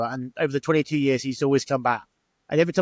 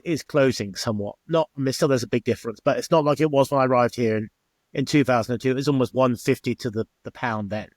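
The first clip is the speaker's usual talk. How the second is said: usual speaking voice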